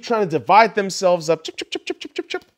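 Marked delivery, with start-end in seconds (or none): 1.4-2.4 mock chipping sound. Mocking division.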